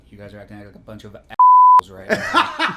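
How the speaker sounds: loud laughing scold like a mother; scolding mom. Acted. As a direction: yell at the boys like mom.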